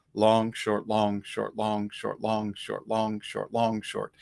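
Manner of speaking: "long" held, "short" clipped, repeated as a chant; long short rhythm. Shown as a demonstration. Teacher chanting long short to show the hornpipe rhythm. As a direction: swing it long then short. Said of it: "a little bit of a blend".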